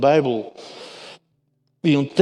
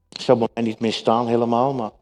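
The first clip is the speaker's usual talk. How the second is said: ordinary talk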